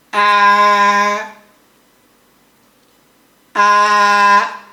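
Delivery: sustained flat first-tone "ah", loud and even. Shown as a demonstration. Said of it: "Can you practice with me".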